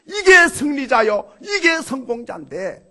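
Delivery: 높고 크게 외치며 선언; 승리를 선포하듯 외쳐라. Real, not acted. 설교 절정의 선언. (청중에게 승리자를 외쳐 선포하는 설교)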